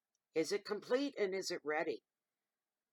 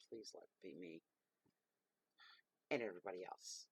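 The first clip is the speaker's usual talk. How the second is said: muttered aside; 8 semitones lower, 10 dB quieter than usual. Real, not acted. Aside wishing for luck.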